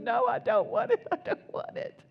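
high, half-whispered mock whine; childish refusal. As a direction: whimper the refusal like a sulking child. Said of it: "instead of going".

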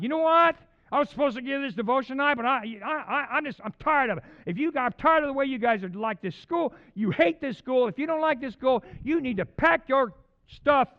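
high, loud, furious retold rant. Young counselor venting anger at his dorm floor, yelling. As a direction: tear into the guys on the floor, shouting. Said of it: "I was ranting".

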